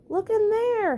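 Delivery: high, loud eager call; excited chipmunk idea. Acted. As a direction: burst out with the discovery. Dale gets an idea.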